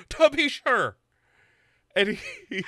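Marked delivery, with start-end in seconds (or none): 0.1-1.0 high, loud mock Irish accent; hammed-up Irish accent, pitched high. Bo joking in a hammed-up Irish accent. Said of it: "react to the accent".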